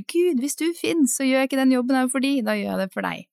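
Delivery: half-shouted plea to God; retold call to God. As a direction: call out to God, pitch up high, then settle. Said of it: "jeg roper bare".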